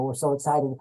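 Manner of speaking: usual speaking voice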